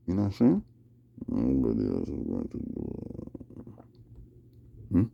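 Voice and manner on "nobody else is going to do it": deep voice. Pretend. Repeats line in deep voice.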